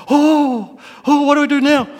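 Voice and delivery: high, loud panicked outcry; hammed-up alarmed cry; mock panic. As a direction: yelp it like you're caught out. Panicked reaction retold.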